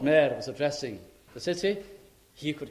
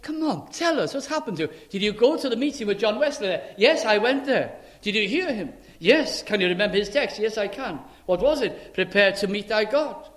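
high, loud quick back-and-forth; lively pub dialogue; 6 semitones higher, 5 dB louder than usual. Acted. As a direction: act out the eager questions.

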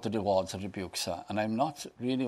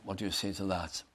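usual speaking voice

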